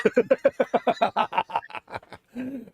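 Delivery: high burst of laughter. Real, not acted. Host cracking up at his lamppost joke, high laughing.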